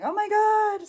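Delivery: high, loud mock squeal; imitated high-pitched exclamation; excited reaction. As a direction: re-enact an excited "oh my God" moment. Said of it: "that's me in the corner going".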